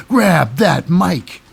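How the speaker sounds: slow, punched mock call; hyped-up exclamation. Host hypes Rhea Ripley grabbing the microphone, drawn-out call. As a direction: stretch each word like a hype man.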